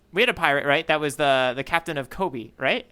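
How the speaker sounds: ordinary talk, nothing marked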